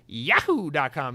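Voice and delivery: whooped, cartoonish "yahoo" in an email address; Mario. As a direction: burst into the mascot's Italian-accented catchphrase. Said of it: "then I do the Mario".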